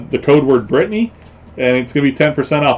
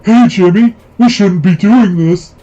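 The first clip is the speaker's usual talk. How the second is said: higher, louder, drawn-out mimicked woman's voice; mock her with a slow, raised seahorse voice; imitated seahorse voice; 5 semitones higher, 4 dB louder, slower than usual. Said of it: "That's her exact same voice".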